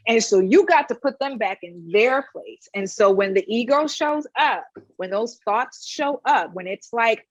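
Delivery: normal talking voice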